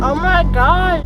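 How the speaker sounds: loud startled exclamation; shocked, drawn-out cry; surprised. Real, not acted. Car vibrating loudly.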